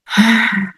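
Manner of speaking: long audible sigh of release; deep breath out. Shown as a demonstration. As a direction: breathe out fully to model it for the listener. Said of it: "Take a deep breath in and out".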